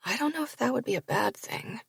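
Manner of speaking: very soft, muttered under breath; mumbled. Acted. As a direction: mumble it to yourself. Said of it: "I finally mumble".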